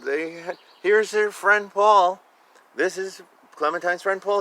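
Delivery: usual speaking voice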